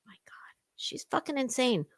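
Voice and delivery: hushed horrified aside; whispering. Real, not acted. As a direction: whisper it under your breath.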